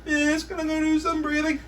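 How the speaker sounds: put-on high whining child; whiny kid. Whiny kid begs the teacher, high whine. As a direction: whine like a pleading pupil. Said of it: "The whiny ones".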